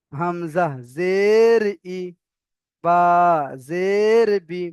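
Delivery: long, swelling "জের", short "ই"; spelling demonstration. Shown as a demonstration. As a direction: recite slowly, stretching "জের" in an arch. Teacher models letter-by-letter spelling.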